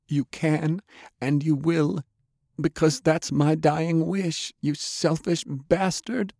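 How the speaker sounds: high-pitched woman's voice, sorrowful; dying woman, pleading. A narrator's reading. Undead Delilah asking Jake to let go, pleading. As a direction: plead gently in a high female register.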